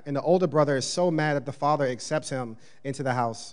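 normal talking voice

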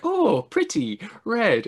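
high-pitched imitation of a delighted woman; exaggerated high "ooh, pretty" voice; mock admiring coo. Voices woman admiring red purse.

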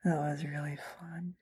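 hushed whisper. Real, not acted. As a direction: whisper it under your breath. Whispered aside after laughing.

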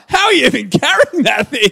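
high, loud, hammed-up incredulous cry; laughing, exaggerated astonishment; mock amazement. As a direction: holler it in comic disbelief. Mock amazement at tiny schoolchildren.

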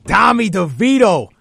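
loud, high hype for Tommy; hyped name call. Real, not acted. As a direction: shout the name like a fan.

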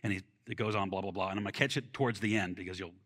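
normal talking voice

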